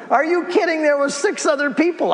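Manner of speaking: real excited outburst to the audience; excited exclamation. Real, not acted. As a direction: burst out with a defiant no-way. Denies whispering, spoke out boldly.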